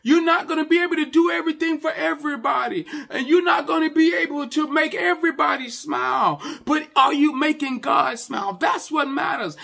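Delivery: high, loud, insistent pulpit cry; preaching at full force. Real, not acted. Pastor warning the congregation about pleasing people, preaching at full force. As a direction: drive each phrase home at high pitch.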